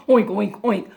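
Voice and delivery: short storybook pig oinks; pig oinking. A narrator's reading. Pig joins the noisy animals, quick oinks. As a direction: oink in a quick run like a piggy. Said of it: "each of the animals joined in".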